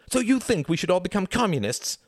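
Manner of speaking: high-pitched indignant challenge; cried out. A narrator's reading. Ruth confronting Reginald's politics, crying out. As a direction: cry it out, pitch soaring. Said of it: "cried Ruth".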